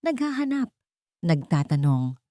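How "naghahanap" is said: ordinary delivery, nothing marked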